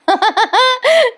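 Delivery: high-pitched peal of laughter; real high amused laugh. Real, not acted. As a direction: crack up at your own retelling. Storyteller amused by the scene.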